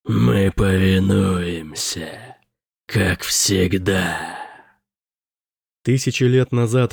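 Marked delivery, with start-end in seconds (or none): 0.0-4.4 slow, scornful demon drawl; resentful demon, very slow; eldritch horror. A narrator's reading. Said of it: "Его нечеловеческий голос дрожал от жгучего презрения".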